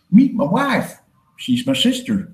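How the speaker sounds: mock hillbilly drawl, starting high; put-on backwoods hillbilly accent. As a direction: introduce the wife as a cartoon hillbilly. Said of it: "that's what hillbillies are".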